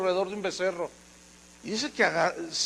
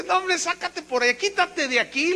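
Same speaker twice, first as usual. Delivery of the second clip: dismissive brush-off; 10 semitones higher than usual. Acted. Pharaoh dismissing Moses.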